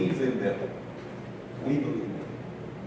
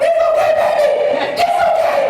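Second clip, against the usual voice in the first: much higher and louder, fussing church ladies; church women comforting; 7 dB louder than usual. Retold or imitated. Church women comforting the crying graduate, high and loud. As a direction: coo it out like the church mothers. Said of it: "all the women like".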